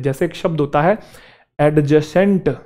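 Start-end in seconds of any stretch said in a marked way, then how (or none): 1.6-2.6 "एडजेसेंट" with the D sounded out; D pronounced. Shown as a demonstration. Teacher showing a common mistake, "एडजेसेंट" with audible D. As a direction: put a separate D before J in "एडजेसेंट". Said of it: "एडजेसेंट नहीं है वो".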